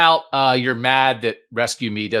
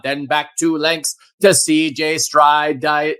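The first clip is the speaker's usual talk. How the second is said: high, sustained track-caller chant; horse-race announcer; 6 semitones higher, 6 dB louder than usual. Pretend. Announcer calling the first Buckeye Derby, race-call voice. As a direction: call the race in a driving, ringing monotone.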